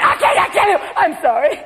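high, breathless I can't; panicky, tearful babble; frantic hormonal wife. Retold or imitated. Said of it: "She'll be going".

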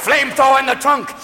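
usual speaking voice

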